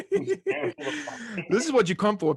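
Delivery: words breaking into real laughter; laughing. Real, not acted. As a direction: laugh through the line, can't hold it in. Laughing at own rant.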